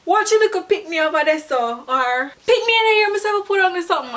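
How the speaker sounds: put-on high, fast patois scold at a kid; mild scolding. Shown as a demonstration. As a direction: snap at the naughty child, fast and high. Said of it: "a Jamaican person would say".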